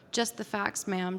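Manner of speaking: normal talking voice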